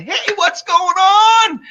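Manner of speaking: breeze in shouting a friendly hey; a big hammed-up shout of welcome; mock upbeat greeting. Retold breezy entrance greeting.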